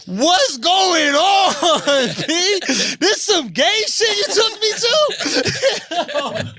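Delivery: high, very slow, laughing outraged yells; shocked moviegoer shouting. Retold or imitated. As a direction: drawl it out like a stunned guy in the theater. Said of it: "You just hear people like".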